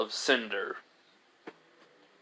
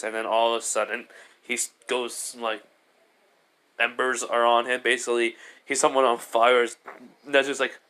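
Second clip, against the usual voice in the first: usual speaking voice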